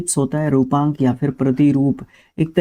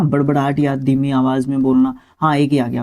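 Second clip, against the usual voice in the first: usual speaking voice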